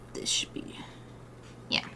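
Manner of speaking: soft whispered aside; whispering. Real, not acted. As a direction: whisper it to yourself. Whispered aside while checking paint.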